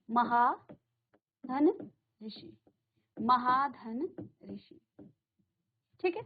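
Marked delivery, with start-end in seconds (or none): 0.1-2.6 महाधन drawn out slowly; slow dictation. Shown as a demonstration. Teacher introducing the next example slowly. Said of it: "एक और हम यहाँ पढ़ेंगे". 3.2-4.8 महाधन ऋषि said slowly, each part apart; slow dictation. Shown as a demonstration. Teacher spelling out the new example. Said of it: "एक और हम यहाँ पढ़ेंगे".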